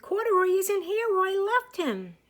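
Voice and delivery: put-on little girl crying out her lost bear; upset exclaim. A narrator's reading. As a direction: cry out for Mommy in distress. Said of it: "she exclaimed".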